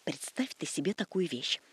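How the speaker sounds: low, hushed secret whisper. Acted. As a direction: whisper it like juicy gossip. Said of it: "зашептала".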